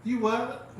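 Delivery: baffled disbelief. Retold or imitated. Speaker replying to her angry friend, baffled. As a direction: act out not believing your ears.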